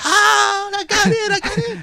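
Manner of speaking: high, loud yell of joy; excited yelling. Retold or imitated. Teen reading his Yale acceptance, yelling with joy. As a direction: shout it like the letter just said yes. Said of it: "And I started yelling".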